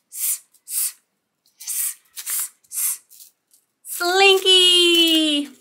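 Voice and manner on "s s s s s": "s" hissed, no voice; letter sound demo. Shown as a demonstration. Teacher sounding out "s" for toddlers. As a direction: hiss a long "s". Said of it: "S sounds like s".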